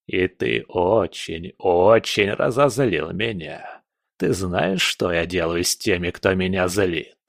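slow, drawn-out threat; menacing old spirit. Acted. Angered spirit threatens intruder, slow menace. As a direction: stretch it out as a villain savoring anger.

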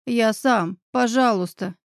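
ordinary delivery, nothing marked

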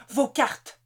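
ordre sec, plus haut et plus fort. A narrator's reading. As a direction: aboyer un ordre aux otages. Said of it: "ordonna-t-il". (ordre cassant, plus aigu)

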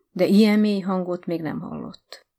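ordinary delivery

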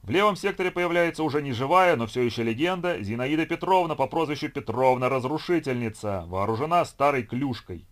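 loud, high showman's welcome; booming circus ringmaster. A narrator's reading. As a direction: boom it into the mic like a ringmaster. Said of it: "объявил конферансье".

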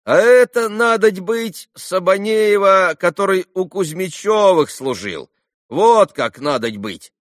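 high, loud, drawn-out village-woman voice; peasant woman guessing. A narrator's reading. Market woman suddenly guessing who is meant, loud realization. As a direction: work it out aloud with sudden certainty. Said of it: "догадалась вдруг одна баба".

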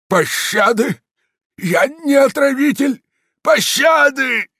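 high, loud, pleading shout; desperate cry for mercy. A narrator's reading. Beaten man begging the mob for mercy, screaming. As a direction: scream for mercy as the blows rain down. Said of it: "из его уст с потоком крови вырывались слова".

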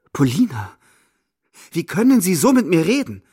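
high, loud reproachful cry. A narrator's reading. Narrator cries reproachfully at Polina, high and loud. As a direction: cry out at her in hurt reproach. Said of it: "rufe ich vorwurfsvoll".

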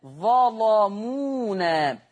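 ظلمونا recited slowly with thick lam; heavy lam. Shown as a demonstration. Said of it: "خلي بالكم من تغليظ اللام".

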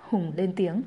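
plain reading voice

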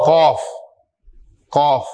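قوف، حلق سے گول کر کے; قوف، کاف کو ذرا گول اور گہرا کریں; ق کا گول تلفظ. Shown as a demonstration. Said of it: "یعنی تھوڑا سا پاؤچ جو ہے نا وہ آپ نے بنانا ہے". (استاد بچوں کو ق اور ک کا فرق سکھاتا ہے)

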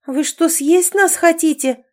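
high, loud, stretched-out alarm; horrified cry. A narrator's reading. Captive girl fearing she'll be eaten, horrified cry. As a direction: cry out in horror. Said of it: "в ужасе закричала Катя".

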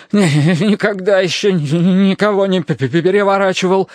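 high, shivering stutter; stammering assurance. A narrator's reading. Terence assures friends he never capsized boats, shivering stammer. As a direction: assure them through repeated stammers. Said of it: "заверил Терренс".

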